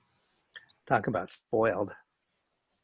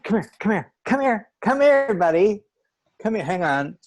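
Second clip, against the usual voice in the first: high, loud coaxing call to a dog; 9 semitones higher, 8 dB louder than usual. Real, not acted. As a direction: coax the dog over, sweet and high. Calling the dog over.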